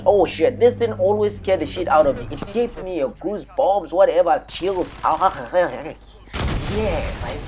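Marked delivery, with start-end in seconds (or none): none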